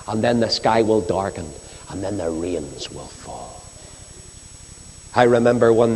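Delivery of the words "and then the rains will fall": ordinary talk, nothing marked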